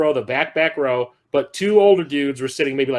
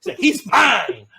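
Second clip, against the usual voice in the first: swooning mom; 7 semitones higher than usual. Retold or imitated. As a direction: draw it out like she's melting. Mom swooning over actor.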